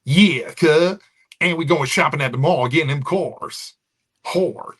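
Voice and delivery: high, loud California voice with overdone R's; exaggerated West Coast R-heavy accent. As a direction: lean hard on every R, California style.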